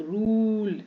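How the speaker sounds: "रूल" with the ऊ held long; stretched long u. Shown as a demonstration. Teacher modelling u plus final e, long vowel. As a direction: draw out the oo in "रूल". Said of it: "इसको लंबा खींचना है".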